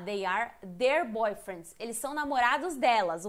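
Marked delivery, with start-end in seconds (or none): 0.0-1.8 slow, deliberate English answer; teacher modelling the answer slowly; slow answer demo. Shown as a demonstration. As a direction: lay out each word for learners. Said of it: "eles falam".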